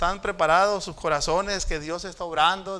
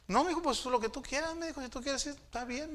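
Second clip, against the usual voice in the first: imita al padre, agudo y bajito, casi resignado; déjalo ir con voz suave de papá; 7 semitones higher, 7 dB quieter than usual. Retold or imitated. (padre accediendo, voz más aguda y suave)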